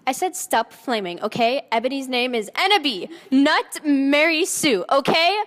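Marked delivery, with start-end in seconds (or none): 3.3-5.4 slow, pointed and annoyed; insist, fed up with critics; defensive snap. Acted. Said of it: "author's note defending character".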